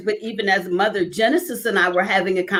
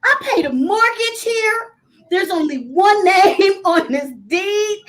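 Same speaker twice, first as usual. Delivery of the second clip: higher-pitched mock rant at her husband; half-laughing retold outburst, pitched up high; 9 semitones higher than usual. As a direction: replay the fury of going off alone at home. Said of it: "But I was going off".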